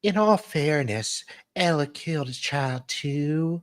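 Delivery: high voice with long vowels. Acted. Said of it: "In all, on [name]".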